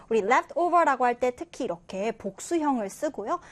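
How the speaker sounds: ordinary talk, nothing marked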